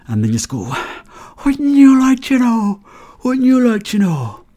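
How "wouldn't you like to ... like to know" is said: high, slow, mock-mysterious sing-song; coy teasing. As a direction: tease them like a diva hiding a secret. Teasing mock-mysterious reply to friend.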